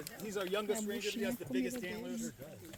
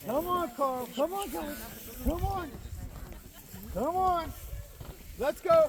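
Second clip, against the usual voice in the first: calling out; 6 semitones higher, 9 dB louder, slower than usual. Real, not acted. Calling the reindeer to come.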